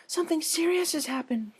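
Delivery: high, breathless, urgent plea; a timid mare voice pushed into a rush; high-pitched panicked Fluttershy at the door. Acted. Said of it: "Fluttershy quickly told her".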